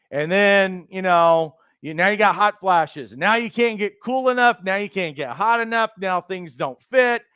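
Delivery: ordinary talk, nothing marked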